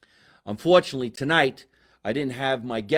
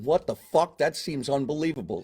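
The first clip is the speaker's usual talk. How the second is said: usual speaking voice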